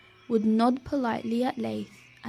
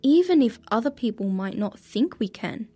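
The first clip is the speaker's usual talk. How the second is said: put-on reading to children; 5 dB louder than usual. A narrator's reading. Moral told to listeners.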